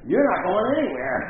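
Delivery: high, loud, furious telling-off; retold angry yell. Brother shouting at the nurse that she can't leave. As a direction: refuse her flat, shouting. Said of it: "And my brother says".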